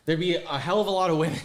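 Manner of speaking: loud, oddly punched delivery on hell; odd emphasis. Real, not acted. Friend overdoes a line about downtown Orlando, loud odd stress. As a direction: leans hard on hell, a bit loud. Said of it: "I don't know why I said it like that".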